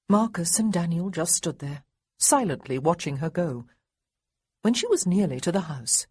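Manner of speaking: ordinary delivery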